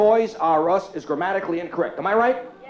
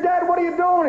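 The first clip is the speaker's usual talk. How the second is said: high, loud exasperated question; yelling at dad; 10 semitones higher, 4 dB louder than usual. Retold or imitated. Son yelling at his father in the hot car, exasperated. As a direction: demand it of your father. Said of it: "I said".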